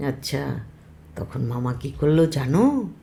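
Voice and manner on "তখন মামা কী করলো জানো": ordinary delivery, nothing marked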